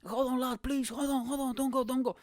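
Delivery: high, pleading mock call; hammed-up desperate plea without full volume; imitated yelling runner. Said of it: "I see somebody running".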